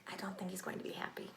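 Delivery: quick secret whisper; fast unvoiced aside. Real, not acted. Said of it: "aside whispered to children".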